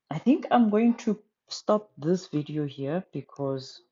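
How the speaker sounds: normal talking voice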